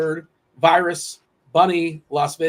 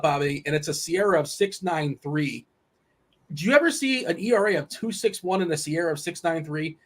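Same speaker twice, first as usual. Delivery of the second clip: normal talking voice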